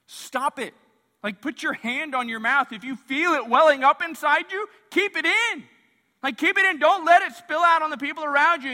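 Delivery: high, loud preacher's exhortation; urgent command. Acted. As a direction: build from a sharp order to an insistent shout.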